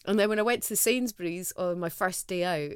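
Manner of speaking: usual speaking voice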